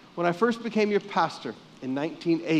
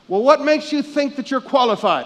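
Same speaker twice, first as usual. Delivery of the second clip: doubtful, pushy question, a bit louder; skeptical challenge; 7 semitones higher, 8 dB louder than usual. Retold or imitated. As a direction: challenge him like a doubting elder. Skeptical missionaries question young pastor.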